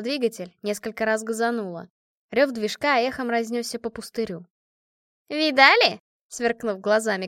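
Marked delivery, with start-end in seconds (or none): 5.3-6.0 high, loud boastful cry; exultant raised-voice shout; triumphant shout. A narrator's reading. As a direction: call it out to show off. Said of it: "крикнула Машка".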